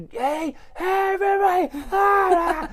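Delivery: frantic high-pitched scream, hammed up. Parent bursting in on the kids in a panic, screaming.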